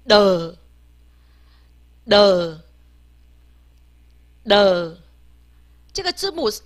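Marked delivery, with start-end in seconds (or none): 0.1-5.0 的 as a low, voiced, drawn-out "duh"; 字母发音示范. Shown as a demonstration. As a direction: blend t and g into one sinking 的. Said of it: "我们念的". (低沉拉长地念“的”，浊音下降)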